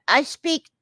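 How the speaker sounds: normal talking voice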